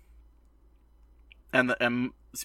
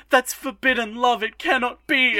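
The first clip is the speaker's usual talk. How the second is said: high, loud, swooning mock lament; theatrical forbidden-love wail, much higher; mock melodrama; 10 semitones higher, 5 dB louder than usual. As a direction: ham up a scandalised schoolgirl. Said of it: "she's literally just like".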